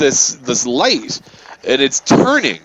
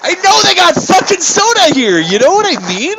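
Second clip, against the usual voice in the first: high, loud disbelieving rant; incredulous rant; 3 dB louder than usual. Real, not acted. Ranting to a friend about her soda question, high disbelief. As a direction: squeak out the disbelief.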